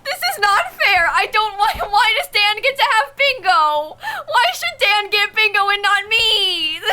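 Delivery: high, wailing protest close to tears; indignant whine. Real, not acted. Streamer protesting Dan's bingo win, indignant high whine. Outraged that Dan got bingo.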